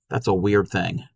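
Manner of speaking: ordinary delivery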